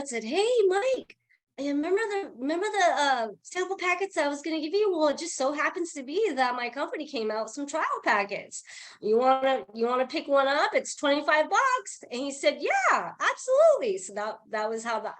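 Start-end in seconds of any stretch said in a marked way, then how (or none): 0.2-12.0 much higher, chirpy phone pitch voice; upbeat sales pitch. Retold or imitated. Retold upbeat sales call to Mike. 12.6-14.0 much higher, keen agreement; eager yes. Retold or imitated. Mike's eager yes on the phone.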